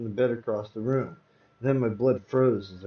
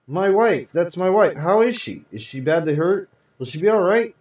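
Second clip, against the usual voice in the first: urgent, high and loud questions; anxious pleading; 4 semitones higher, 5 dB louder than usual. Acted. Husband begging the doctor about his wife, loud and anxious. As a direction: press the doctor for answers, near panic.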